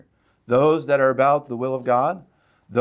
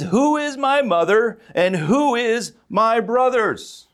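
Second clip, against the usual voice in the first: slow, high, louder Jesus question; voicing Jesus; 10 semitones higher, 5 dB louder than usual. Preacher voicing Jesus to the crowd, slow and high. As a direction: let Jesus ask it slowly, rising up.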